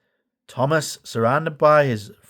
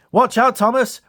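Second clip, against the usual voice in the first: much higher engine call; Whiff warning; 10 semitones higher, 4 dB louder than usual. A narrator's reading. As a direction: warn Thomas before crashing through. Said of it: "Whiff puffed".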